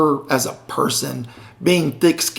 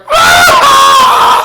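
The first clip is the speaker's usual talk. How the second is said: long high-pitched yell; loud mock shriek held on one note; imitated loud outburst; 9 dB louder than usual. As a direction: mimic a friend's wild, drawn-out scream. Imitating Karen's outburst at the bill.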